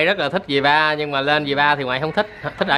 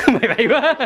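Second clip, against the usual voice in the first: laughing repeat; 7 semitones higher than usual. Real, not acted. Nhắc lại lời bà, cười.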